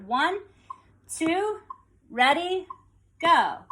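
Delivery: high, spaced-out rhythmic count-in. Shown as a demonstration. Teacher counting students in together, measured rhythmic count-in. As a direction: cue the group in, a beat between each word. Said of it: "we'll count this together".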